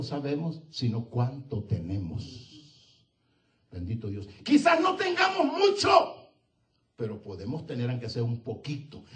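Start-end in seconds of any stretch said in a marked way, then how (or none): 4.4-6.3 sudden high shout from the pulpit; preaching shout. Real, not acted. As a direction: belt it out to the church. Preaching burst. (grito de predicador, agudo y fuerte)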